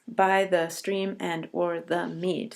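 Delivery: slow, measured line, each beat even; slow syllable reading. Shown as a demonstration. Teacher showing the class the seven-beat rhythm, slow. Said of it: "seven syllables".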